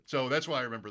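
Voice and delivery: ordinary talk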